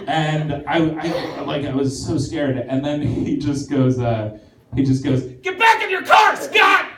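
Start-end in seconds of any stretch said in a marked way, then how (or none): 5.4-6.9 sudden high yell at full voice; cop's shout. Retold or imitated. Cop yelling at Scott to get back in his car, loud high shout. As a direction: bark the order across the lot.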